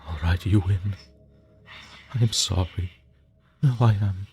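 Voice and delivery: loud, desperate begging to a screaming ghost; loud frightened begging, stammering; terrified pleading. Acted. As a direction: plead for your life, voice cracking.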